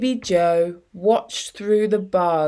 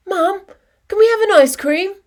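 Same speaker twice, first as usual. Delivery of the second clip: put-on high, fast, eager little-girl plea; a child begging for ice cream, quick and bright; excited child; 12 semitones higher, 5 dB louder, much faster than usual. A narrator's reading. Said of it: "Ella ran up with her bag swinging".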